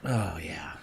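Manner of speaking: hushed whispered awe; whispering. Real, not acted. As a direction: breathe it out softly. Gazing at thickened shot.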